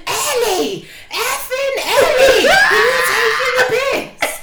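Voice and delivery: high, loud, laughing mock shout; mock furious shout. Quoting her outburst, high laughing shout. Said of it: "She went".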